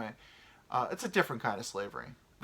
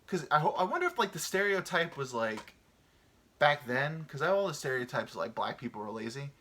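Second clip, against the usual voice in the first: ordinary talk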